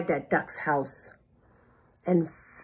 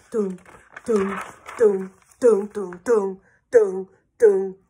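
firm rhythmic dooms, building louder; steady pounding "doom, doom"; footstep noises; 4 dB louder than usual. Acted. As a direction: thump out charging steps with your voice. Animals' charging footsteps imitated.

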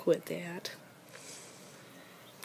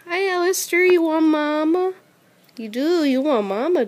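high, loud cooing to a pet; sing-song, high-pitched doting; 9 semitones higher, 7 dB louder than usual. Real, not acted. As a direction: gush at your little pet in a high, excited voice.

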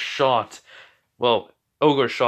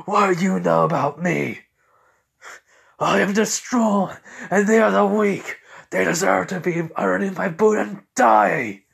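raised-pitch, furious acted tirade; angry villain rant; 8 semitones higher, 4 dB louder than usual. Ogre gets up and rages at Izuku, angry ranting. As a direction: rage back in a high, forceful voice.

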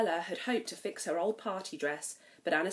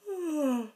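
gasp; 8 semitones higher than usual. Acted. Prince's gasp.

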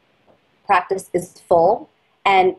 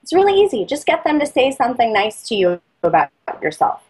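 ordinary talk, nothing marked

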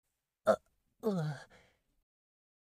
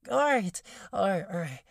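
flustered; 3 semitones higher, 8 dB louder, faster than usual. Acted. Flustered snapping back to task.

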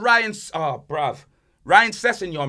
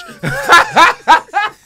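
shrieking high burst of laughter; cracking up loud and high; 14 semitones higher, 10 dB louder than usual. Real, not acted. Laughing loud at the punchline.

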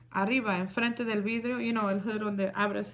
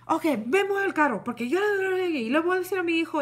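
angry neighbor; 7 semitones higher than usual. Retold or imitated. Retelling the neighbor insisting she move the car, higher and bossy. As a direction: imitate the neighbor barking at her. Neighbor lady threatening with her son.

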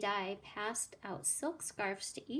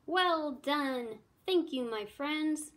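higher, louder hearty thanks; delighted praise; 5 semitones higher, 4 dB louder, slower than usual. A narrator's reading. King applauding his guests' performance, delighted praise. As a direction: praise the whole crowd heartily.

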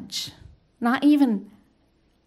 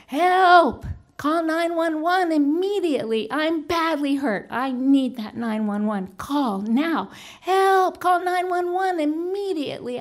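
yelling for help; 6 semitones higher, 3 dB louder than usual. Retold or imitated. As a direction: call out urgently to the whole street, re-enacting it. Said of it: "my mouth is yelling as loud as possible".

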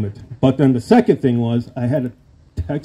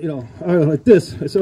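ordinary delivery

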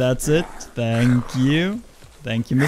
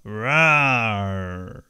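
zombie growl; 3 semitones lower than usual. Pretend. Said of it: "I have to act like a zombie".

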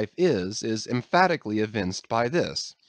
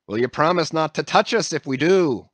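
demanding; 5 semitones higher, 5 dB louder than usual. A narrator's reading. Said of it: "demanded their ringleader".

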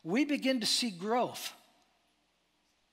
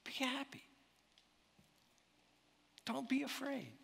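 soft reassurance; 6 semitones higher, 9 dB quieter than usual. Acted. Soft paraphrase of Jesus.

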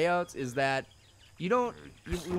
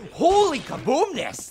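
amazed exclamation; 10 semitones higher, 7 dB louder, slower than usual. Real, not acted. Reacts to game explosion.

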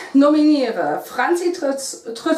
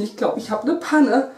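usual speaking voice

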